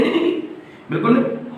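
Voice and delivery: high, playful no no no; playful denial. Real, not acted. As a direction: brush off the worry with a teasing protest. Teacher denies giving many questions. (ऊँची, चंचल मनाही)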